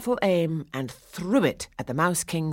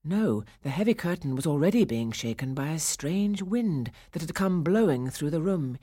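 ordinary delivery

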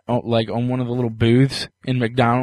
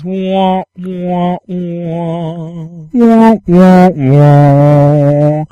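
sad trombone wah wah; 4 semitones higher, 6 dB louder, much slower than usual. Pretend. Mocking a failed joke.

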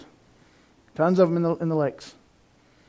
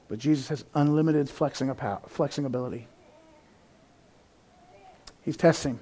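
quiet it right down; hushed; 6 semitones lower, 9 dB quieter than usual. Real, not acted. Pastor hushes to the congregation, soft and low. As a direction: drop to a soft, low hush. Hushed aside after loud preaching.

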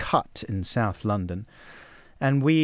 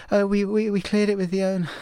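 higher, feeble excuse; defensive excuse to the old lady; sheepish; 9 semitones higher than usual. Retold or imitated. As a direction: squeak out a lame excuse. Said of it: "we're like".

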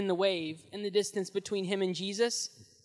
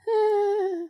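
startled gasp; 12 semitones higher, 4 dB louder than usual. Acted. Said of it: "and he goes".

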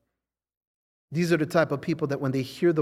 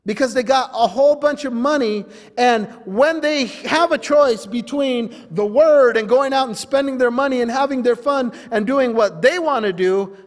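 raised pitch, driving pulpit delivery; forceful preaching; 7 semitones higher, 6 dB louder than usual. Real, not acted. Preacher warning about money over the word, forceful preaching. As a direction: hammer it out at the congregation.

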